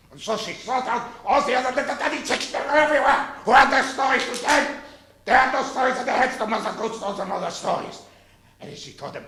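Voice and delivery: bawdy nursery tale in mock German; put-on German-accented storyteller. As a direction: tell it with a hammed-up German accent. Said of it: "Tell the real story of Mother Goose in this accent".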